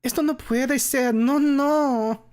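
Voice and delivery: loud high despairing cry; cry out in dismay. A narrator's reading. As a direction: wail at the ruined flower beds. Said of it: "cringe and cry out".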